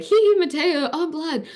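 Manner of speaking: light, high-pitched send-up of her own scenes; playful scene quote. Retold or imitated. Showing an interviewer her light scenes, playful and high.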